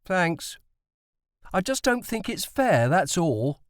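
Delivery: put-on high, sulky boy's protest; a boy grumbling it isn't fair. A narrator's reading. Tim protests to his dad at supper, high and sulky. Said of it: "Tim protested".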